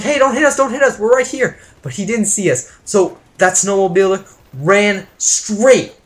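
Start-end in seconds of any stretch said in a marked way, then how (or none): 0.0-1.6 high, urgent yell at the driver; panicked calling out. Retold or imitated. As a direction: shout a warning, voice pitched up. Calling out to oncoming snowmobiler.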